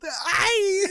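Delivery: high needy hey, give me; a mock two-year-old reaching for it; whiny toddler. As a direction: beg like a grabby toddler. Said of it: "like a fucking two-year-old".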